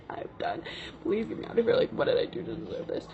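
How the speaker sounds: half-whispered mock pleading; hushed, hammed-up despair; desperate plea. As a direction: retell a desperate plea under the breath. Retells her desperate plea to escape.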